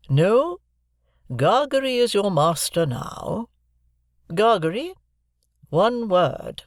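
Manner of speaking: put-on grand, measured old lady handing over money; Miss Havisham's voice. A narrator's reading. Miss Havisham giving Joe the premium, stately and slow.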